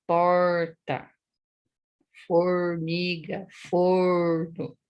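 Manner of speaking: Paraná retroflex R, held long; slow, marked Paraná R; Paraná accent. Shown as a demonstration. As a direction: curl the R like in Paraná: "porta, formiga, forno". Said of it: "Aqui no Paraná".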